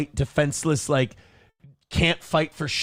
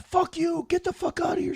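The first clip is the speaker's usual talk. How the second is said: mock yelling; 4 dB quieter than usual. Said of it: "shit like that".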